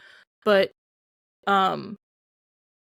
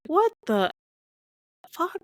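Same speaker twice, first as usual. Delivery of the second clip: stunned outrage; 10 semitones higher than usual. Retold or imitated. Viewer reacting to the dad.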